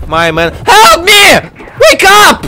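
ordinary talk, nothing marked

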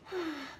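a soft breath out; breathy exhale. Shown as a demonstration. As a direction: blow out a breath. Demonstrating breathing.

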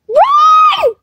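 high-pitched screams of alarm; panicked screaming. Real, not acted. Player fleeing a hacker in game, panicked screaming. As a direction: shriek it as you flee.

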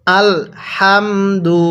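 "হামদু" with a light puff of air on the হা; measured "আল হামদু", airy first sound in "হামদু"; breathed-out হা. Shown as a demonstration. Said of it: "এরকমভাবে পড়ব". ("হামদু"-র হা হালকা বাতাস ছেড়ে টেনে পড়া)

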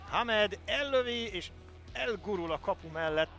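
excited sports commentary. Real, not acted. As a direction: build to the shot. Attacks called in commentary.

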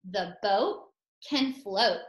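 slow, clear model sentence. Shown as a demonstration. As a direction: say it slowly so children can copy. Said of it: "and the sentence".

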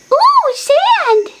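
put-on tiny high baby voice; baby puppy voice. Little Everest coos at the sand, high baby voice. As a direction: coo like a curious baby pup.

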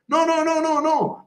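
put-on a boy on the beanstalk brushing the dragon off; Jack refusing. Jack refusing the dragon's help, very high. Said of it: "gli risponde Jack".

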